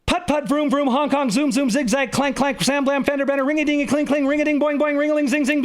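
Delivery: high, nonstop rattle of noise words; breathless rapid patter. A narrator's reading. Teacher firing off words to close the warp, high rapid rattle. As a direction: race through on one breath, pitched high.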